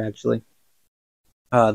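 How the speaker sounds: ordinary delivery, nothing marked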